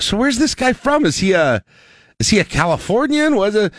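retold question, pitched high; mock bewilderment, voice lifted high; puzzled questioning. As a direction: ask it in high, curious puzzlement. Retelling his puzzled question.

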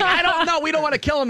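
high, loud, laughing protest; high panicky protest. Retold or imitated. Talking the Irish guy out of violence, high panicky protest. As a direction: squeal to hold him back. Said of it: "I'm like".

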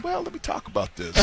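smooth coach impression. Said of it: "He'd be like".

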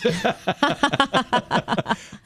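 long hearty chuckle; amused laugh. Real, not acted. Host amused by an Irish friend's reply, hearty laugh. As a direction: laugh it out after the quote.

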